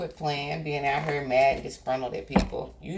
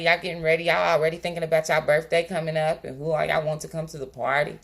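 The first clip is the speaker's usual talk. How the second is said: ordinary talk, nothing marked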